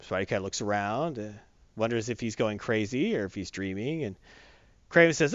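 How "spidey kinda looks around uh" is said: normal talking voice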